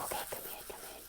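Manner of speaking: hushed whisper, barely voiced; sneaky whispered welcome. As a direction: whisper so the sleeping sister won't hear. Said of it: "'cause we were trying to be real quiet".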